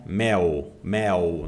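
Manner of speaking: MEL ending in a w-like glide, "méu"; loose-tongued L. Shown as a demonstration. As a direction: say mel Brazilian-style, tongue left loose. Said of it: "Nós falamos o L com a língua solta". (mel com L solto, "méu")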